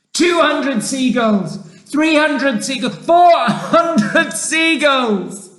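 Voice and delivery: excited, mounting shout. A narrator's reading. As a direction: call out each count, stretching the last. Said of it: "he shouted".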